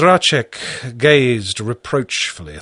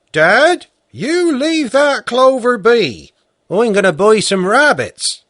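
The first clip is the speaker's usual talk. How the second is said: retold warning to Dad; 11 semitones higher, 5 dB louder than usual. Peasant retells telling his father to leave the clover, loud and insistent. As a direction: warn Dad off selling the clover.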